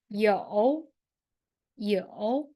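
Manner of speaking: 有 said carefully with a dipping third tone; teacher modelling a word. Shown as a demonstration. Teacher asks the class to listen for the tone, careful. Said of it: "What do you think about the tone?".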